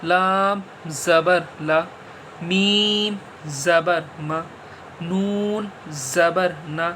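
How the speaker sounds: slow, syllable by syllable letter drill; slow spelling drill. Shown as a demonstration. Teacher drilling zabar letters for pupils, slow and deliberate. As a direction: spell each letter out slowly for children. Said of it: "ہجے کر دیجئے".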